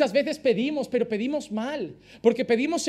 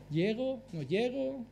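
quiet calculating; 8 dB quieter, slower than usual. Retold or imitated. Snake calculating its strike.

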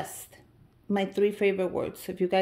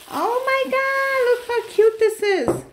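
very high, louder, stretched gushing; squealing excitement; 8 dB louder, slower than usual. Real, not acted. Unwrapping the purple tissue gift, high squeal. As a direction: gush over it in a high squeal.

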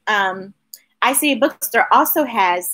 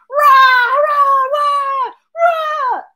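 high loud cheering; cheering rah; 14 semitones higher, 4 dB louder, much slower than usual. Acted.

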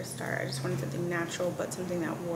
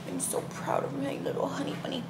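tender whisper; 5 semitones higher than usual. Real, not acted. Doting on her baby son.

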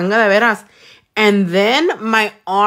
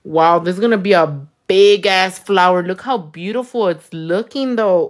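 usual speaking voice

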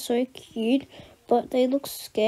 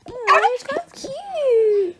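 delighted; 11 semitones higher, slower than usual. Acted. Delighted reaction to dog.

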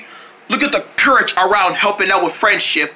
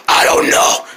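scary voice; 7 semitones lower than usual. Acted. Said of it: "your voice sounds scary".

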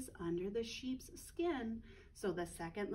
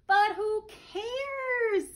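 high, slow, loud carefree pig voice; carefree little pig; 9 semitones higher, 9 dB louder, much slower than usual. Acted. Little pig shrugging off work, carefree. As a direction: toss it off like a lazy little pig.

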